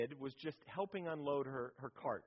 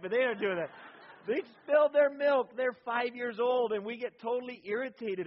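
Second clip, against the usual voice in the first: emphatic preaching; 7 semitones higher, 10 dB louder than usual. Real, not acted. Preacher pleading with parents, loud and emphatic. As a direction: drive the point home. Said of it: "preaching emphasis".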